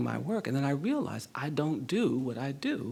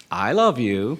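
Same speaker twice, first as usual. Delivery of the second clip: put-on louder, grudging childish declaration; mock insincere; 9 dB louder, much slower than usual. Boy made to say it to a stranger, insincerely. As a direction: imitate a boy forcing it out insincerely. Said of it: "as insincerely as I possibly could".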